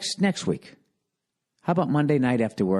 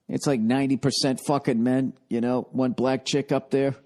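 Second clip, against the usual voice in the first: ordinary talk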